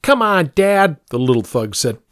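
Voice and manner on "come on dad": put-on high, goading child voice; bratty kid. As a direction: egg on dad like a brat. Bratty kid egging on dad.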